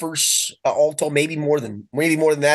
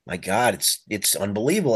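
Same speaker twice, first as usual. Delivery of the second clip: hushed awe; 6 semitones lower, 3 dB quieter than usual. Retold or imitated. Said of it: "He's like".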